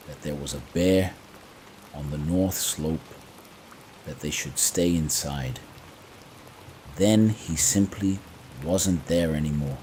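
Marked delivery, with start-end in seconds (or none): none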